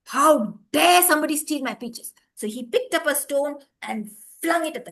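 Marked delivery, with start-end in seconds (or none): 0.0-2.1 angry outburst, louder; furious. A narrator's reading. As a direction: demand it in fury. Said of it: "he was furious".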